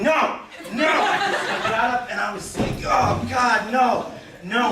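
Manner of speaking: high, laughing cries of no; laughing panic. Retold or imitated. To the lady as the brick sinks, laughing panicky no.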